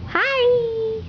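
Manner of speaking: very high, bright greeting; high sing-song hi. Shown as a demonstration. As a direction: chirp hi up high for baby. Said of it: "Say, "Hi, Poppy".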